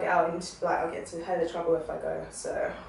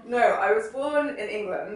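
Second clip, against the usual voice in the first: ordinary talk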